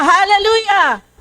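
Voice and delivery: high ringing call of praise; shouted hallelujah. Shown as a demonstration. Preacher calling the church to shout it. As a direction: lead the crowd, call it out high. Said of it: "Yun, isa pa".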